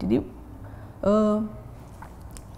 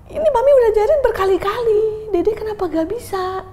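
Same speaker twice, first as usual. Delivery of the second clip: high, loud, fast scolding; angry scolding; 14 semitones higher, 6 dB louder than usual. Retold or imitated. Mother angrily scolding her child over homework. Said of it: "saya marah gitu loh sama dia".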